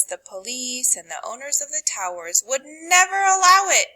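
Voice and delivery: ordinary delivery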